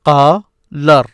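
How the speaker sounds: "Qalar" as qaa, long gap, lar; teacher's split demo of "Qalar"; broken into syllables. Shown as a demonstration. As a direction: sound out "Qalar" in two separate pieces. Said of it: "This is what you hear".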